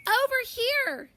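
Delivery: put-on children on the bus calling George over; eager cry. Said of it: "they cry".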